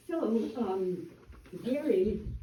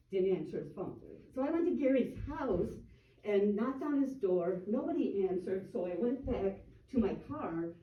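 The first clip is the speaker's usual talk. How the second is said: ordinary delivery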